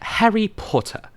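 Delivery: high, slow British accent. Shown as a demonstration. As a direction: show off a British accent, stretched out. Actor answers in British accent.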